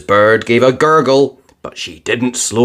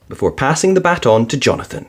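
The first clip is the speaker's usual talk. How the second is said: usual speaking voice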